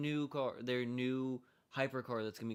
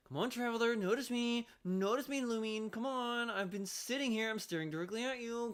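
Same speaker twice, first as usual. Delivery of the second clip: mock character voice; 11 semitones higher than usual. Streamer imitating the posing girl calling traveler, in a high voice. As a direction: playfully voice the impatient girl. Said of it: "just like".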